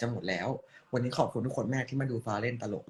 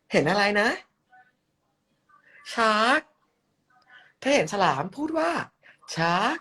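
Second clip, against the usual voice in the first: high, bright cutesy host voice; cute character voice; 7 semitones higher, 7 dB louder than usual. Acted. น้องฟ้าฟ้า asking viewers to say shark, high and cutesy. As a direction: play a sweet little mascot teaching a word.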